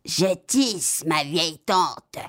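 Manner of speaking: lightly voiced gruff cat answer; a hint of a cat putting on a big voice; understated gruff cat reply. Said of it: "répondit le chat d'une grosse voix".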